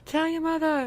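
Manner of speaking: high-pitched playful repeat; playful high call. Pretend. Host jokingly urging listeners to spread the word, playful high call. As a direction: say it up high, teasing.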